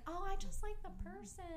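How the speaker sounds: high, put-on lilting voice. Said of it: "What, what was that voice you just did?".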